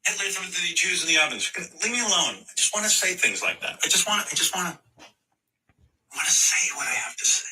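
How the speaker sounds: high, loud mock salute cry. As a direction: blurt it as a parody. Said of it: "mock Kyrie".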